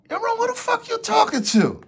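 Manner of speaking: loud, high-pitched indignant challenge; acted confrontation. Storyteller confronting the runner over his entrance, indignant shout.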